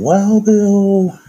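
high, loud, slow drawn-out call; hype announcer call. Acted. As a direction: stretch the name out like a hype man. Shouting out friends' names.

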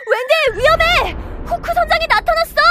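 high urgent warning shout; alarmed shout. Acted. Warning Wendy of danger.

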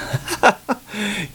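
short breathy laugh at the noise. Real, not acted. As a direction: laugh at the rolling batteries. Amused at batteries rolling.

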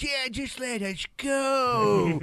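pleading quote. Retold or imitated. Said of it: "He was like".

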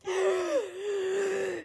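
high, soft wheezing laugh; long squeaky giggle; helpless laughter. Real, not acted. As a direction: laugh until the breath runs out. Reader breaks up at the word.

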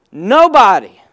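usual speaking voice

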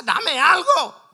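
high, loud plea for scraps; desperate begging. Retold or imitated. Preacher voices the woman begging Christ for crumbs, desperate begging. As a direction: cry out for even the smallest bit. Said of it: "ella desesperada".

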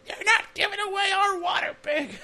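very high, shouted, shrill-sounding mom voice; mock mom yelling. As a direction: yell it from the kitchen as the mom. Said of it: "the mom yelling from the kitchen".

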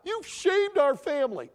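high, loud mock outburst; emotional shaming. Preacher showing parents what not to say, loud shaming cry. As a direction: denounce like an overwrought parent. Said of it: "That's emotional abuse".

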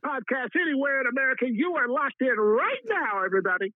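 high, booming radio announcer. Shown as a demonstration. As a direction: hype it up like a radio host. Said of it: "in your best radio voice".

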